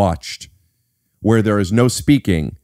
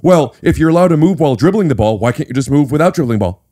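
higher, louder whiny nitpicker; mock objection; 6 semitones higher, 4 dB louder than usual. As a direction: mimic a petty rules-lawyer. Mocking a naive rules objector.

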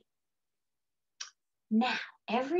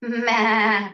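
loud, wavering goat "maa"; goat bleat; 6 dB louder than usual. Shown as a demonstration. Teacher showing children the sound of that goat, goat bleat. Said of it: "make the sound of that goat".

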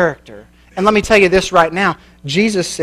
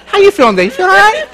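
higher, louder, laughing through the words; laughing mock concern; 12 semitones higher, 4 dB louder, faster than usual. Preacher teasing the congregation, laughing mock concern. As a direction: tease him while cracking up. Mock checking on depressed man.

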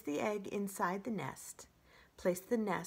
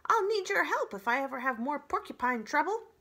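high, loud, sly fox; 9 semitones higher, 7 dB louder than usual. Acted. Fox joking to the robot, high and sly. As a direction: tease her as a cocky fox.

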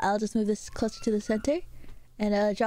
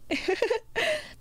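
high-pitched burst of laughter; real amused laugh; high giggle; 12 semitones higher than usual. Real, not acted. Amused during stream.

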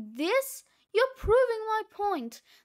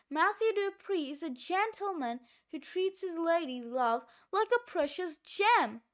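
usual speaking voice

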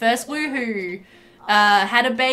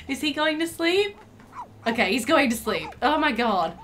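usual speaking voice

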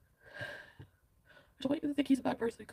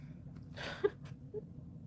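tearful laugh; 9 dB quieter than usual. Real, not acted. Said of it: "I don't know if I should laugh or cry".

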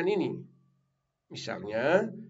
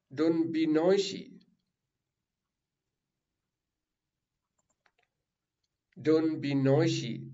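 slow model sentence; 4 semitones higher, slower than usual. Shown as a demonstration. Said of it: "Jangan ribut".

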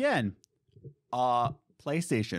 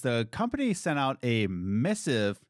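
usual speaking voice

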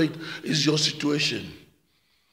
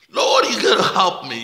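desperate plea; 3 semitones higher, 5 dB louder than usual. Acted.

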